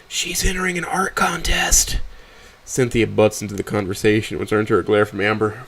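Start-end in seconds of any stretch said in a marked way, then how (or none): 0.1-2.0 high, whispery sister voice, slow; tattling sister. Acted. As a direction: butt in and tell on your sister. Voices Cynthia butting into the conversation.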